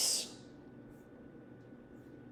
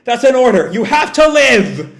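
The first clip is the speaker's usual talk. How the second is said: high, loud commanding yell; raised, forceful, desperate shouting; 7 semitones higher, 7 dB louder than usual. Acted. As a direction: yell an order at a dying friend. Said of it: "Shiki yells".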